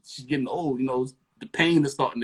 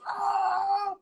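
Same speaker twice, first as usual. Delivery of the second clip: high shrill scream; imitated shriek. Friend screaming over FaceTime at the pregnancy test. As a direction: let out a high yelp. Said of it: "It goes".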